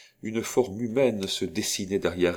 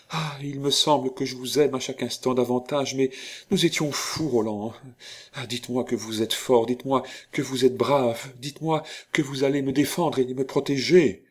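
higher-pitched, fervent feminine entreaty; impassioned plea; 6 semitones higher than usual. Acted. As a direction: beg him to protect you, building urgency. Heroine pleads for protection. (supplication passionnée)

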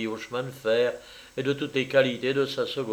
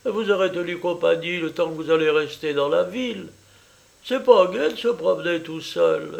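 higher, effusive Italian mother welcoming a guest; eager landlady mother; 6 semitones higher, 5 dB louder than usual. Acted. Mother welcoming her daughter's French lover, eager and high. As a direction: gush over the visitor, pitched up. Mother regrets Francesca's absence.

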